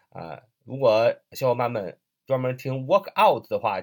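ordinary talk

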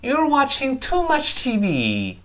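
"You're watching too much T V" high and drawn out, with a final fall; slow model reading of "You're watching too much T V", high then dropping; exaggerated intonation. Shown as a demonstration. Teacher repeats for students, "You're watching too much T V" slow, high, falling at the end. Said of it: "音调的起伏".

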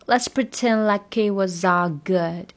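slow, linked let's pretend like it was all good; slow drill. Shown as a demonstration. Teacher drills the swallowed t. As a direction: drop the t and link slowly. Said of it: "it的T一定要吞掉".

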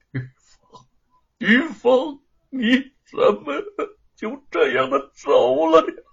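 high, loud, slow wailing cry; wailing for his wife. Acted. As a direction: call out her name in anguish. 丈夫抱着亡妻哭喊. (悲痛地哭喊)

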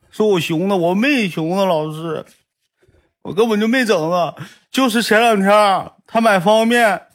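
put-on high, teary child voice pleading his case; sobbing kid explaining. Boy sobbing to his teacher, high teary voice. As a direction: blubber it out like a boy caught by the teacher. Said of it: "别抽搭了".

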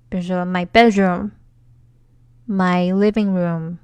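My bedroom and My living room said slowly, room stretched; example phrases. Shown as a demonstration. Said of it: "R，R".

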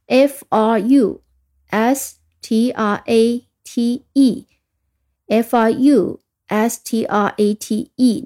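careful drawn-out letters, F R U S T R A T E; slow spelling again. Shown as a demonstration. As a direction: spell each letter slowly and clearly. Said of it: "再听一遍". (再慢慢拼一遍)

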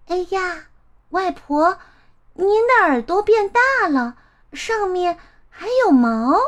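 put-on high child voice, wondering; startled little girl. Girl startled by odd grandma.

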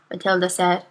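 usual speaking voice

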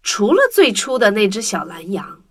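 ordinary delivery

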